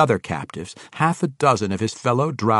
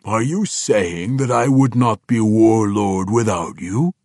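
lightly voiced purring orc, louder; menacing purr; 4 dB louder than usual. A narrator's reading. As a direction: toy with him like a cat with prey. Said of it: "his voice sounded like the purr of a cat".

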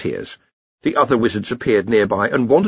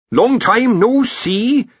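high, drawn-out cheery greeting; cheerful; 14 semitones higher, 5 dB louder, much slower than usual. Acted. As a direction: greet an old acquaintance brightly, stretching the words. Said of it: "said the Senior Wrangler cheerfully".

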